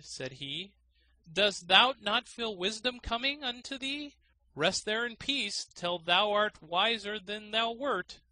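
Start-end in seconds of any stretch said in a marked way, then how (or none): 1.3-8.2 high, mock-solemn taunt; mock sage. A narrator's reading. Gardener mocks the student in the sack, high and gloating. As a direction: gloat in a pompous tone.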